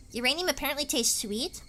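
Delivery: normal talking voice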